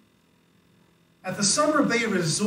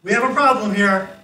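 loud, raised mock yell; retold yelling; 4 semitones higher, 9 dB louder than usual. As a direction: call it out like a quoted shout. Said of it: "the way people were yelling".

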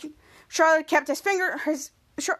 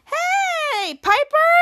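much higher, bright and overeager hello; fake cheerful greeting; 12 semitones higher, 4 dB louder, much slower than usual. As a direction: cover up with a sing-song hi.

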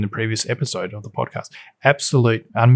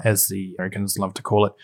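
ordinary delivery

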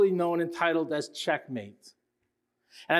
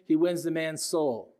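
ordinary talk

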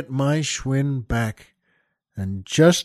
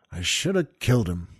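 weary mom; 9 semitones lower, 7 dB quieter than usual. Retold or imitated. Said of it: "his mom just shakes her head upstairs and says".

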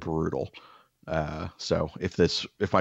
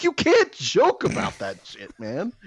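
higher, louder, slow indignant protest; 5 semitones higher, 4 dB louder, slower than usual. Retold or imitated. To the cardiologist after his prank, slow indignant scolding. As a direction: scold him for the bad joke, slowly. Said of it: "And I was like".

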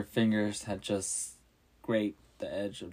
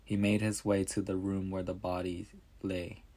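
ordinary delivery

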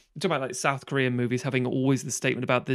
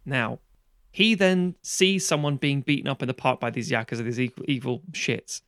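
usual speaking voice

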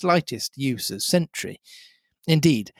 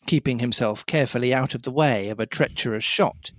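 ordinary delivery, nothing marked

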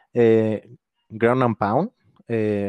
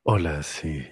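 low, soft seductive testimony; sultry narrator; 4 semitones lower, 4 dB quieter than usual. Pretend. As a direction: hotline voice, low and hushed. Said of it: "ni que fuera, este, línea de hotline".

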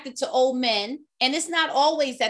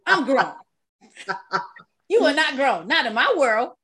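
laughing retort; 5 dB louder than usual. Retold or imitated. Repeats it laughing.

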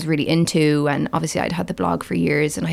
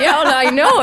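laughing; 9 semitones higher, 6 dB louder than usual. Retold or imitated. Laughing while retelling mum's remark.

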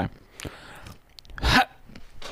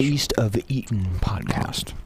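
ASMR whisper; 3 semitones lower than usual. Pretend. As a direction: whisper it slowly, ASMR style. ASMR parody intro.

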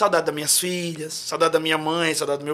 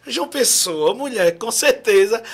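louder, insistent retort; a touch louder, teasingly sure; emphatic; 3 semitones higher, 7 dB louder than usual. Retold or imitated. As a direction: push back with playful conviction. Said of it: "Eu disse".

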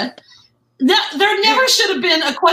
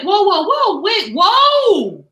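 louder, higher startled whoas; alarmed whoa; 6 semitones higher, 5 dB louder, much slower than usual. Retold or imitated. Replying to the accusing agent, mock startled. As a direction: back off in a shocked voice. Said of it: "And I was like".

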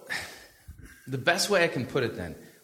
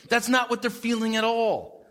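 ordinary talk

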